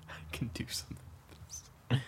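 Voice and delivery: breathy conspiratorial whisper. Acted. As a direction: whisper it like a secret plan. Echoes line in whisper.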